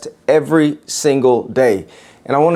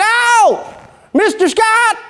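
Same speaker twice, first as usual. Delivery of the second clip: high-pitched East Texan cowboy; East Texan accent; slower than usual. Acted. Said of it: "in this rich East Texan accent".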